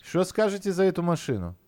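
put-on playful Odessa accent lilt. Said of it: "Ты как в Одессе".

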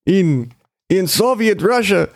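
lightly put-on Russian accent. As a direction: ham up a fake Russian accent. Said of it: "Let me get an offensive Russian accent ready".

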